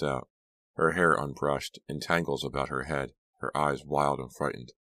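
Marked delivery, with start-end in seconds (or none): none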